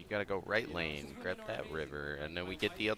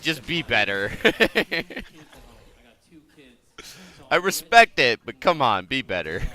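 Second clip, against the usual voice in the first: loud laughing plea; 7 semitones higher, 17 dB louder than usual. Real, not acted.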